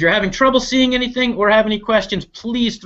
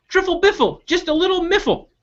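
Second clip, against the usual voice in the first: high nonsense rhyme; squeaky sing-song bird; silly little bird; 13 semitones higher than usual. Acted. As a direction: bubble out the nonsense giddily.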